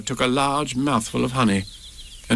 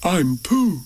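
higher-pitched storybook bear introduction; Pooh; 5 semitones higher than usual. A narrator's reading. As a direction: introduce yourself simply. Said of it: "said Pooh".

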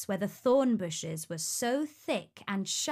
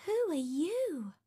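curious princess; 7 semitones higher than usual. A narrator's reading. Princess wakes and questions the prince, curious high voice. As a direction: ask it high, full of curiosity. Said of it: "full of curiosity".

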